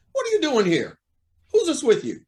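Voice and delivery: high-pitched, taken-aback questioning; startled demand. Acted. Judge to unexpected visitors at his door, startled. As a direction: demand to know what this intrusion means.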